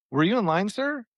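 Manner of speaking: lightly voiced cashier, sir? squeaks up high; a hint of a prim clerk asking politely; mock polite cashier. Cashier asking the customer if he was in line, lightly mock polite.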